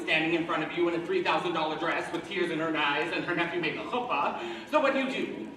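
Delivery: ordinary talk, nothing marked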